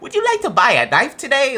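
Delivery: high, polite mock salesman pitch; a chirpy fake-sweet knife seller voice; door-to-door salesman. Mocking a knife salesman at the door with high chirp. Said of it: "walking up to your door".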